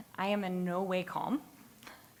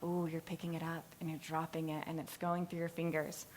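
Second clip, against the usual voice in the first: soothing; 2 semitones lower, 7 dB quieter than usual. Retold or imitated. Parent soothing crying child.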